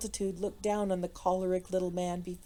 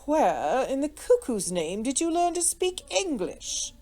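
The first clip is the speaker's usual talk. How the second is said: high, slow, incredulous drawl; astonished gentleman stretching out the question; wonderment; 5 semitones higher, 4 dB louder than usual. A narrator's reading. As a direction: marvel openly at the foreigner. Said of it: "with undisguised wonderment".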